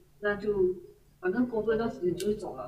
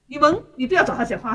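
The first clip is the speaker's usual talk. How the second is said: louder, scolding retold; retold angry telling-off; 5 semitones higher, 8 dB louder than usual. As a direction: snap at the chatty staff member, louder. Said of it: "他就很生气".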